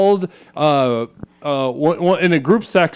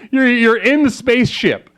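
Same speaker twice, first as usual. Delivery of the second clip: ordinary talk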